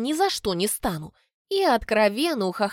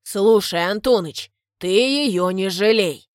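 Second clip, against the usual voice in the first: gruff father giving orders; stern father; 6 dB louder, slower than usual. A narrator's reading. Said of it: "говорит он".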